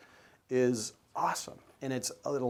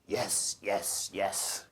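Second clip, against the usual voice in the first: put-on light French-accented yes, yes, yes; a hint of a French accent, agreeing; 4 semitones lower, much slower than usual. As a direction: imitate the photographer in a French accent. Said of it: "in this French accent".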